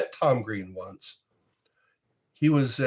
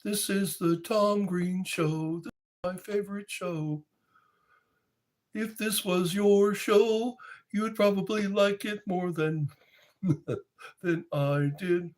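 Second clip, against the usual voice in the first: Tom Green impression; 8 semitones higher than usual. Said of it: "He was on M T V".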